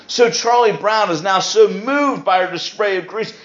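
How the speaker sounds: normal talking voice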